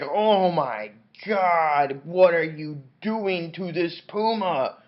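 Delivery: melodramatic, stretched-out exclamation; mock horror. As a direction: wail it in hammed-up outrage. Acted horrified outcry at the puma.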